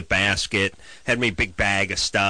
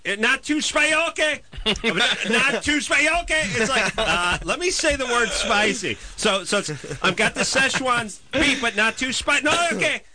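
much higher, fussy ordering voice; mock customer ordering; 11 semitones higher than usual. Caller anxiously ordering Thai food, voice pitched up high. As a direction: keep insisting it's not too spicy, pitched up high. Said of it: "you ring the police department".

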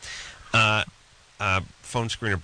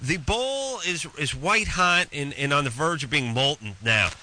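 exasperated comic rant; 7 semitones higher than usual. Real, not acted. Host rants about hot microwave bowls.

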